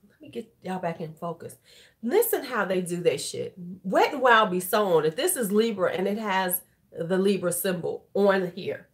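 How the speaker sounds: normal talking voice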